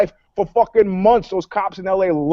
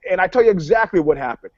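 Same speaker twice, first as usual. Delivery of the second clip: normal talking voice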